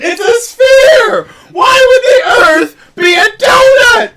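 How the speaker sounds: high, loud yelling through laughter; disbelieving shout. Real, not acted. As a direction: shout it in total disbelief, cracking up. Incredulous shouting about moon shape.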